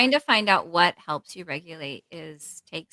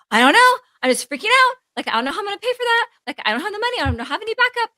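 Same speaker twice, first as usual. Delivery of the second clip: much higher and louder, a fast rush of panic; panicked freaking out; 9 dB louder, faster than usual. Retold or imitated. Imitating her own panic about paying bills, much higher and rushed. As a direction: replay her own money panic as it hit. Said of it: "And I'm like".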